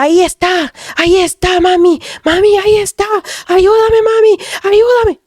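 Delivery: high-pitched panicked cries for help; screaming for mom. Retold or imitated. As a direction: act out a terrified son crying out, pitch way up. (gritos de auxilio, agudos y desesperados)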